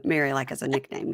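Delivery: ordinary delivery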